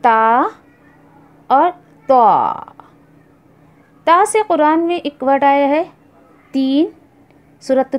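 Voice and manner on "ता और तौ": ता light, then तौ heavy and drawn out; Arabic letters ta and tau; two Arabic letters. Shown as a demonstration. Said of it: "फिर उसके बाद लफ्ज़ है".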